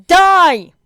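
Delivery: loud, savage scream of a throw; battle yell. A narrator's reading. Bakugo throwing at the enemy team, savage yell. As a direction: roar it as you hurl the ball.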